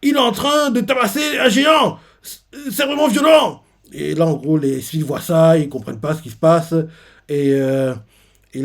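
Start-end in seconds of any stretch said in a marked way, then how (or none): 0.0-3.6 high, loud alarmed yelling; horrified shout. Retold or imitated. Onlookers shout as the king beats a giant in alarm. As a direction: cry out in horror at the beating. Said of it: "Et ils font".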